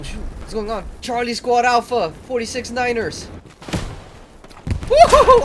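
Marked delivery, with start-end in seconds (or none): 4.8-5.4 high loud whoop; cheering. Acted. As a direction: whoop with joy. Cheering in game.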